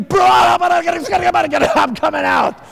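high, loud acted shout; hammed-up roar of words breaking loose; explosive outburst. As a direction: burst out as if held back too long. Acting Spirit bursting out.

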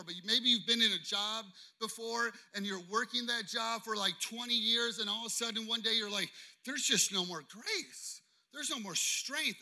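earnest preacher building with emotion; impassioned preaching. Acted. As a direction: press the testimony home to the congregation.

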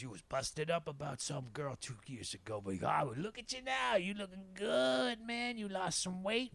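imitated old man rising into high praise; old-timer's put-on voice, climbing in pitch; Bay. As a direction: start level, then lift high and admiring. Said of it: "And he goes, he goes".

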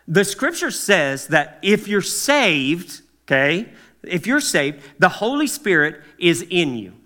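ordinary talk

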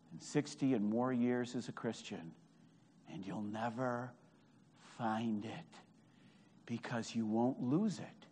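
very soft, low preaching; hushed warning. Real, not acted. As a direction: drop almost to a murmur. Preacher drops to a grave hush.

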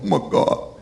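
whispered, awestruck disbelief; stunned under-the-breath whisper; overwhelmed gasped whisper. Shown as a demonstration. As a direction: breathe it out, barely voiced. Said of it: "You don't even say it like that".

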